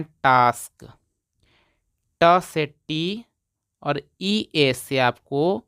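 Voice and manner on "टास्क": "टास्क" with long aa, as a model word; pronunciation demo. Shown as a demonstration. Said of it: "इसको पढ़ते हैं".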